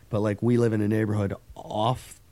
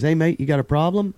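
higher-pitched imitation of an Aussie driver; Aussie accent; 7 semitones higher, 4 dB louder than usual. Aussie driver confronting a pedestrian, imitated Australian accent. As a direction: challenge him in a mock Australian accent. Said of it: "and he was Aussie".

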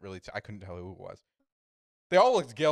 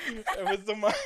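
ordinary talk

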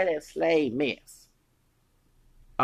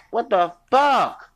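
slow, loud, higher outraged yell; 7 semitones higher, 7 dB louder, slower than usual. Real, not acted. Ranting about a coworker, a slow outraged yell. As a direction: bellow it out in disbelief.

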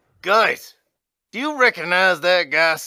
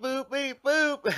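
robot beeps; 6 semitones higher, slower than usual. Pretend. Computer beep noises.

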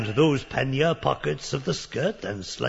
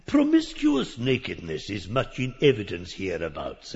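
ordinary delivery

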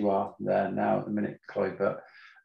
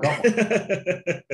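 laughing; 9 semitones higher, 4 dB louder than usual. Real, not acted. Laughing at own axe confession.

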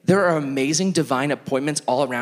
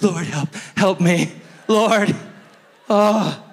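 higher, desperate cry for help; mock panicked plea; 7 semitones higher, 3 dB louder, much slower than usual. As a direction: beg for rescue in hammed-up panic. Acted panicked plea to God.

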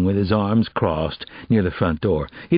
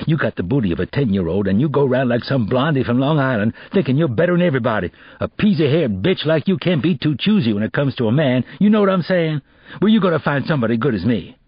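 angry insulting rant; 6 semitones higher, faster than usual. Acted. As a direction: tear into her with insults.

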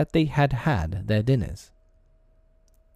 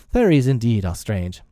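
ordinary delivery, nothing marked

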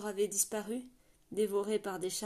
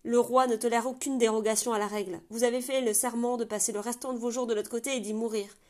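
firm, raised voice through the door; stern loud refusal; 3 semitones higher, 7 dB louder than usual. Acted. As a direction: lay down the rule. Commandant refuse à travers la porte. (le commandant refuse fermement, en haussant la voix)

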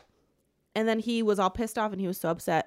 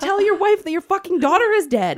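high, loud, half-laughing, half-crying outburst; laughing in disbelief; 12 semitones higher, 9 dB louder than usual. Real, not acted. Fan ranting about a book's non-dilemma, laughing in disbelief. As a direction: crack up in exasperated disbelief.

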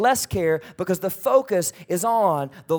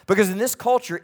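ordinary talk

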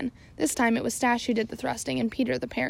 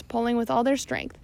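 plain reading voice

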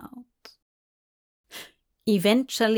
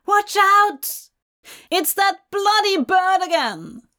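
high, loud shriek of alarm; screaming lady; 9 semitones higher, 4 dB louder than usual. A narrator's reading. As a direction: cry out as a panicked lady. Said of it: "A lady screamed".

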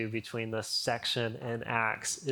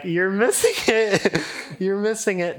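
laughing exasperation; 7 semitones higher, 8 dB louder than usual. Real, not acted. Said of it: "laughing exasperation at the crowd".

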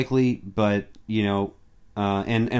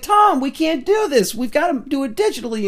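lightly voiced nervous studio exec, higher; a hint of a fretting studio voice; mock studio exec; 12 semitones higher than usual. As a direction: plead a bit with the star. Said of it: "the studio was like".

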